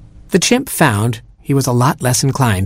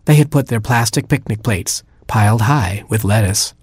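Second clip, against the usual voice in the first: plain reading voice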